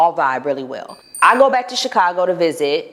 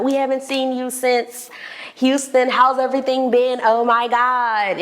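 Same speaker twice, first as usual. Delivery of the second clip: excited greeting; 6 semitones higher than usual. Retold or imitated. Greeting friend in club.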